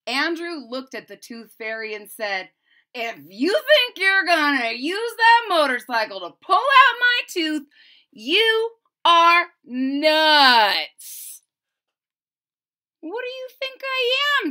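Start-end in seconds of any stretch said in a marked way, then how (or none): 2.9-11.4 loud, high, very slow protest; indignant kid. Acted. Andrew refusing the motorcycle pull, indignant. 13.0-14.5 high sassy retort; sassy fairy. Acted. Tooth Fairy answering Andrew, sassy.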